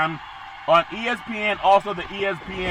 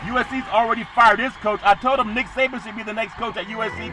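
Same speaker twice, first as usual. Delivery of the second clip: ordinary delivery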